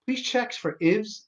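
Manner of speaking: ordinary delivery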